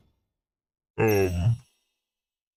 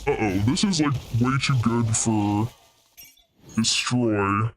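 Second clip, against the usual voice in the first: usual speaking voice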